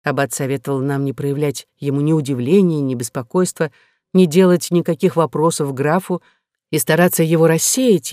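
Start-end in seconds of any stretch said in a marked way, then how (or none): none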